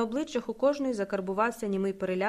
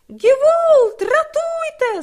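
much higher, loud, slow shriek for help; terrified scream; 13 dB louder, much slower than usual. A narrator's reading. Young man screaming for help as the landlady is beaten. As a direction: shriek in panic.

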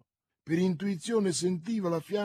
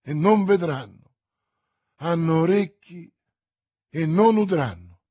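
loud, ringing exclamation; Milady cries out to Felton, loud and fervent; exclaiming; 2 semitones higher, 8 dB louder than usual. Acted. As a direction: proclaim it with fervour, loud. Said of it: "esclamò Milady".

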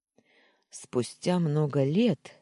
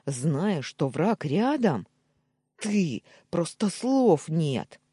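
louder, angry outburst; flaring up; 4 semitones higher, 5 dB louder, slower than usual. A narrator's reading. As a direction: snap at him in sudden anger. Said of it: "вспылил Даниил".

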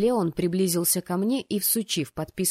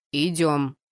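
low, firm order; deep, curt command; commanding fiancé; 4 semitones lower, 4 dB louder than usual. Acted. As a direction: order her along in a deeper voice. Fiancé orders her to come.

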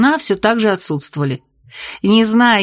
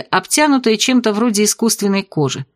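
ordinary delivery, nothing marked

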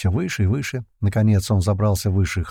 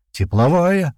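high, raised one-word cry; alarmed yell; 8 semitones higher, 4 dB louder, slower than usual. A narrator's reading. Comrade shouting about a heat-seeking rocket, alarmed yell. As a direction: shout out the danger. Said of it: "заорал Колючий".

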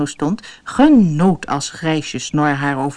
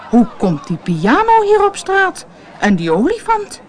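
much higher, amazed outcry; astonished call; 12 semitones higher than usual. Acted. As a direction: gasp it out in disbelief. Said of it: "riep verbaasd".

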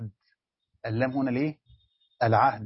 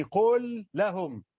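slow drawn phrase; 5 semitones higher, much slower than usual. Shown as a demonstration. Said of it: "يعني".

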